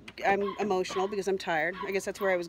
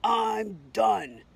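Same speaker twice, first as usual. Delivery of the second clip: mild retold scream; 4 dB louder than usual. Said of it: "I screamed out".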